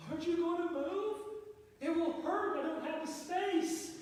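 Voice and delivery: high, put-on petulant voice; whiny demand. Husband whining at his wife in the hallway to move. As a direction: whine at her to get out of the way.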